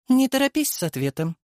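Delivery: high, mocking creature voice; sneering worm king voice. A narrator's reading. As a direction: voice an amused parasite villain. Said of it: "перебила меня Мария".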